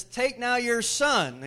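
loud, high, slow proclamation; emphatic command. Acted. God tests Abraham with a command, loud and emphatic. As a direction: proclaim the order, drawing it out.

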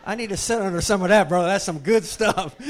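loud, emphatic admiration; excited praise. Retold or imitated. Preacher acting out his reply to his son's insight, loud excited praise. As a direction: belt out hearty approval.

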